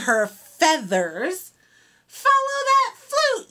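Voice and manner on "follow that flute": high yelling chase call; yelled. A narrator's reading. Fifi and Frog chasing the flute, high yell. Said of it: "yelled Fifi, Frog, Fawn, and Fox".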